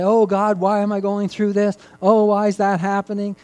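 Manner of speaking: hammed-up whine of why me; loud mock lament; mock complaint. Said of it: "Don't say".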